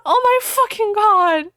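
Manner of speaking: high, loud mock wail; hammed-up cry. Ebony panicking over the leaked video, hammy crying. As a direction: wail it like a soap heroine. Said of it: "I cried".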